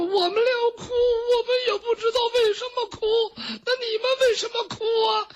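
very high, rushed comic wail; hammed-up squealing cry; sobbing maids. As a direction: blubber it out fast on one high pitch. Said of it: "他们在那抽抽搭搭的哭".

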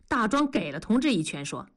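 ordinary delivery

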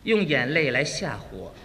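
very low, grave put-on voice; stern husband. Husband answers wife's divorce threat, stern. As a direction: intone with mock manly menace.

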